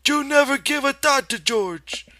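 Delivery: put-on a stern old aunt wagging her finger; scolding aunt in Lenny's voice. A narrator's reading. Aunt Clara scolds Lenny, very high and loud in his voice. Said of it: "she went on in Lenny's voice".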